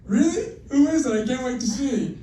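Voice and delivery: put-on high, eager little kid; excited kid voice. Blind kid's eager reply to his parent, high and excited. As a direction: squeal it like a thrilled child. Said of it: "And they're like".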